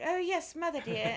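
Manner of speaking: high, prim British "oh yes, mother dear"; mock British accent. Tom Holland slipping into British while staying in character, mock British accent. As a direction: toss off a posh-sounding British line in jest. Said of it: "it gets a little British".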